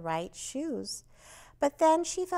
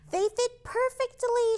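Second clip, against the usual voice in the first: much higher, delighted cry; cried with joy; 11 semitones higher, 4 dB louder than usual. A narrator's reading. Brontorina thrilled with her new shoes, joyful cry. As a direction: burst out with happiness. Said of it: "she cried".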